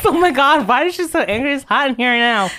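high, loud laughing remarks. Real, not acted. Teasing the angry friend, laughing. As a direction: talk through real laughter.

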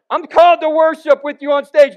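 loud, high mock worship volunteer; high-pitched, shouted mock quote; imitated eager claim. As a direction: belt it out high, imitating an over-keen volunteer. Acts out a would-be worship singer.

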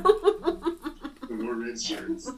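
high, slow laughing words. Real, not acted. Laughing repeat of joke.

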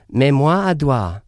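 loud, drawn-out American attempt at French; bad French accent. Shown as a demonstration. Showing how bad his French pronunciation is, bad French accent. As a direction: belt out clumsy French slowly. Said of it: "I'm not doing it right".

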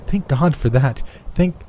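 high grateful cry, cut short; rising relief that stops abruptly; fervent relief. A narrator's reading. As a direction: thank heaven in a lifted voice, then break off. Mother relieved, then breaks off.

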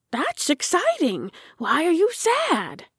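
put-on high, childlike excited questioning; small character's high-pitched wondering; puzzled little tractor. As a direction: perk up high, then ask in concern. Tractor puzzled by Jim's sadness.

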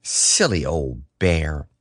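low, slow and tender; murmured fondly to oneself; loving. A narrator's reading. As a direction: linger affectionately on each word. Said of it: "looked after him lovingly".

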